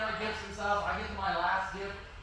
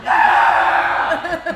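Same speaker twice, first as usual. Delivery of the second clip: loud, high whoop through laughter; laughing cheer; 6 semitones higher, 17 dB louder than usual. Retold or imitated. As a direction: cheer like a delighted kid, laughing. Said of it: "I'm like this".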